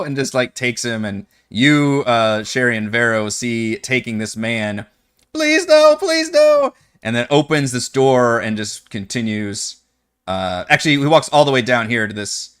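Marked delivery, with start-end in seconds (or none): 5.4-6.7 desperate begging. Acted. Wumpus dragged through casino.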